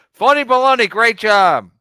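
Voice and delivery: loud, high, sarcastic phony baloney; mock praise. Real, not acted. Mocking a chat commenter with a loud sarcastic jeer. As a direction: jeer it out like a game-show host.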